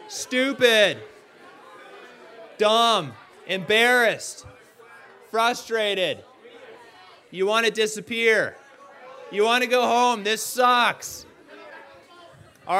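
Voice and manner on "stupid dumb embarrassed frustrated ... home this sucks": higher, louder, each word called out to the room; yelled out. Shown as a demonstration. Preacher yelling answers for the congregation. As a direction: shout every feeling out loud. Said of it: "Yell it out".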